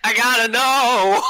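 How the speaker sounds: high, drawn-out mock agony; desperate curiosity. Player torn over the mystery box, hammed-up curiosity. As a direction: stretch it out as if unable to resist.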